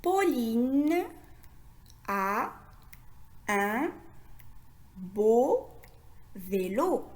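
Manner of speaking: each word alone, long pauses between; lecture mot à mot. Shown as a demonstration. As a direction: read slowly, one word at a time for learners. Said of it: "Essayons maintenant de lire la phrase". (lecture lente, mot par mot)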